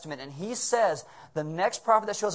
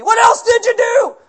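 drill sergeant; 15 dB louder than usual. Retold or imitated. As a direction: bark at the recruits like a drill sergeant. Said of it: "drill sergeant".

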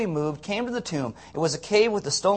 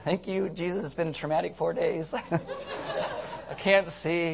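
mock weary Lazarus; 5 dB quieter, slower than usual. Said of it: "Lazarus is like".

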